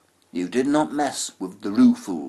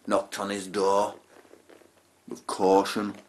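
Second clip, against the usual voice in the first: normal talking voice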